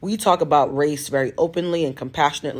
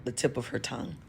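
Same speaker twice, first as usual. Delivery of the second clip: normal talking voice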